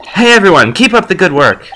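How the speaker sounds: high, bubbly greeting to a group; cheerful calling out. Acted. Young hopeful greets her future co-workers, cheerful calling out. Calls out to crime scene workers.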